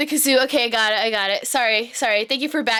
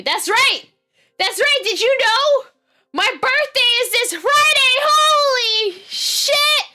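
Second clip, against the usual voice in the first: high, loud birthday hype; loud, thrilled exclamation; excited announcement; 13 semitones higher, 7 dB louder, slower than usual. Real, not acted. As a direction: shout it with rising excitement, stretching the end.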